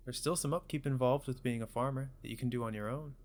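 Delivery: put-on soft-spoken parent checking in on a child; gentle parent. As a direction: voice a caring parent, quietly. Voices parent talking to child.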